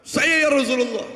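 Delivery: high, hollered answer; villager calling back. Acted. Villager answering the Prophet's call after the sermon, hollering. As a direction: pipe up from the back of the crowd.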